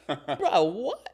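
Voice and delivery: high, loud "Bro, what?" in shock; stunned, voice jumping up; disbelief. Retold or imitated. Meeting a volunteer from his own town, high stunned disbelief. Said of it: "I'm like".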